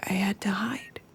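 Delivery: hushed frightened whisper. Acted. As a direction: breathe it out, afraid to be heard. Hiding, scared confession.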